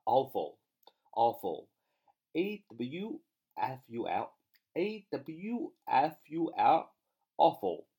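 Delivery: drawn-out letters for students to copy; slow spelling drill. Shown as a demonstration. Teacher spelling a vocabulary word for the class, slow and deliberate. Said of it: "它们分别是awful".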